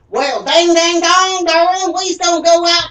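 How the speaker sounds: put-on over-the-top country bumpkin Southern accent. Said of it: "That is what people are expecting".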